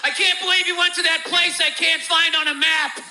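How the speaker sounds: high, loud imitation of angry online critics; mocking outraged critic. Mocking the outraged internet crowd, high and loud. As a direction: sneer it like an indignant troll. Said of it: "the general consensus is".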